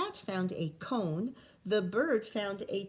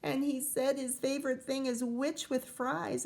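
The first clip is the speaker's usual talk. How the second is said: usual speaking voice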